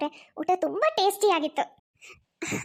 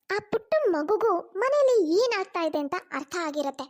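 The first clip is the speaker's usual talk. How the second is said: ordinary delivery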